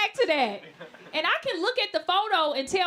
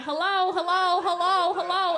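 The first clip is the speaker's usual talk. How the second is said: higher, louder, drawn-out hellos; creepy repeated hello; 6 semitones higher, 4 dB louder than usual. Retold or imitated. Ugly man following comic keeps calling out, persistent hellos. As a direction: keep calling after her, persistent. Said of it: "He kept saying".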